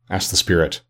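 ordinary delivery, nothing marked

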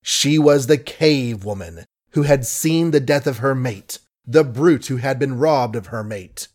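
plain reading voice

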